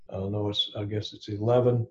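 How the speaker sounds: ordinary talk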